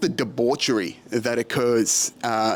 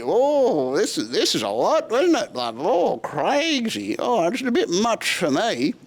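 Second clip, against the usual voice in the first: put-on high, swooping, overwhelmed old tourist; hammed-up dithering oldie, pitched way up; mock old tourist couple; 9 semitones higher than usual. Old tourist overwhelmed by Walking Street, high wavering mock voice.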